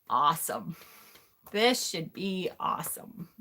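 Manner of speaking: soft, unenthused sarcasm; sarcastic resignation. Retold or imitated. Daughter after being told go to hell, quietly sarcastic. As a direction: say it quietly, dreading it completely.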